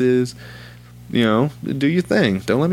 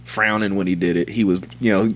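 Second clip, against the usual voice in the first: ordinary delivery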